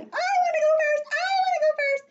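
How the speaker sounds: very high, loud eager yell; shouting child. Acted. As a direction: shout over everyone to get picked. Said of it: "Jones was shouting".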